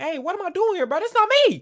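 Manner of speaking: high-pitched, loud mock panic; freaking out. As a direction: imitate her freaking out, voice shooting way up. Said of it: "starts freaking out".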